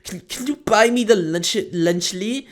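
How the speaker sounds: put-on high-pitched child asking mommy and daddy; put-on high kiddie voice; pleading little kid. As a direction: imitate a kid begging parents, stumbling over words. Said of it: "They're gonna go to their mommy and daddy and ask 'em".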